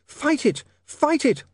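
high, urgent woman's cry; shouted encouragement. A narrator's reading. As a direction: urge him on in a high voice. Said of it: "shouted Sacharissa".

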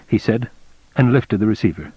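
usual speaking voice

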